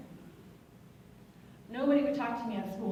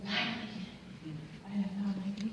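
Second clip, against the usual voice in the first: whispered quote of your own relief; mock-reverent whisper; 2 semitones lower than usual. Veteran recalling the barbecue invitation, whispering.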